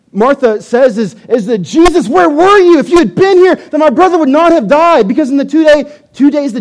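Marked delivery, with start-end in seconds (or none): 1.6-5.1 high, loud, frantic plea; frantic, upset. Acted. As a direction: demand answers, upset and loud. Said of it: "Martha is upset and she's, she's frantic".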